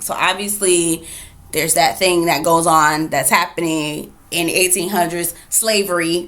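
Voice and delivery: ordinary talk, nothing marked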